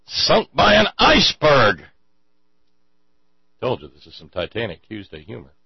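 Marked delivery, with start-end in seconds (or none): 0.1-1.8 much higher, loud outraged shout. Acted. Goldberg to Mister Wong, high outraged shout. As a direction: blurt it out in outrage. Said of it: "shouts Goldberg".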